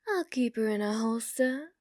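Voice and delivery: usual speaking voice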